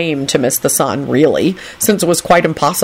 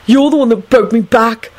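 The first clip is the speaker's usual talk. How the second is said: higher, sullen protest; 6 semitones higher than usual. A narrator's reading. Young man blames his companion, sullenly. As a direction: answer back sulkily in a lifted voice. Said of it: "Neville replied sullenly".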